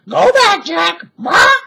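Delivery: very high, loud parrot squawk. A narrator's reading. Parrot warns the children, high squawk. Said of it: "she said".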